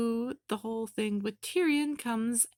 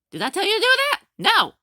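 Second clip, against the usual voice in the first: much higher, much louder angry shout; furious scolding; 9 semitones higher, 11 dB louder, much faster than usual. Retold or imitated. Salem scolding Cinder for acting without orders, shouting. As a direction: blast her for disobeying.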